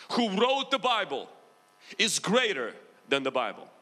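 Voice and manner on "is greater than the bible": ordinary delivery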